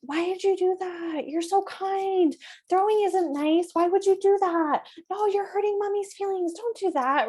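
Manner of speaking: high-pitched, overwrought mock lecture; pleading parent. Shown as a demonstration. Parent over-explaining to a child who threw something, high-pitched pleading. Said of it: "This is not the time to be like".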